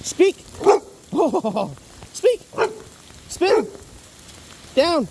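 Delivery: high, bright commands to the dog. Real, not acted. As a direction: snap out tricks in a high voice.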